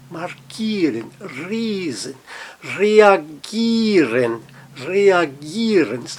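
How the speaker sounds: markieren, riesen, reagieren with a held "ee"; long I. Shown as a demonstration. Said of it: "Um I longo".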